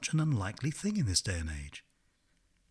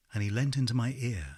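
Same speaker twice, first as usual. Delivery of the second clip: ordinary delivery, nothing marked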